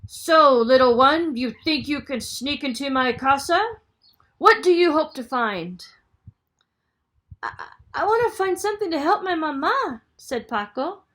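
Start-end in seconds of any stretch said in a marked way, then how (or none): 0.1-5.8 loud, menacing giant voice; bellowing giant. Acted. Giant questioning Paco in his house, bellowing. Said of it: "El Gigante Terrible bellowed". 7.4-10.0 high, fast, stuttering; scared boy tripping over words; stammering fright. Acted. Paco pleading to the giant, frightened stammer.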